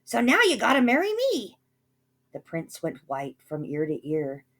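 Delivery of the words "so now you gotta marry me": very high, loud gloating squeal; gleeful scream. A narrator's reading. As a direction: screech in greedy triumph. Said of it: "But she screamed".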